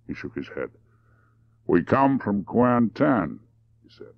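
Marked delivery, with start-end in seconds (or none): 1.7-3.4 slow, higher-pitched drawl; unhurried, deliberate answer. Acted. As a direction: take your time over every word. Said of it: "he said in his deliberate way".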